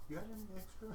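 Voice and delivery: high question. Acted. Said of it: "and I'm like".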